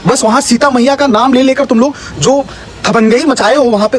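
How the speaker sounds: normal talking voice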